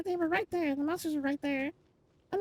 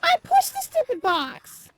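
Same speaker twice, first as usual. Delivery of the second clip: slow, high, much louder complaint; frustrated yell; 10 semitones higher, 11 dB louder, slower than usual. Real, not acted. The box didn't help, a slow frustrated yell. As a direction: drag out each word in real exasperation. Repeats complaint, then yells it.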